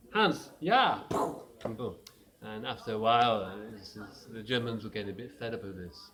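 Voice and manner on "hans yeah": calling out. Acted. Soldier calls back across trenches.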